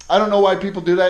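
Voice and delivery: heated repeat of the complaint; exasperated outburst. Real, not acted. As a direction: blurt it out in real frustration.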